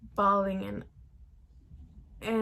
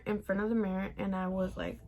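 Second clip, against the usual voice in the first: ordinary delivery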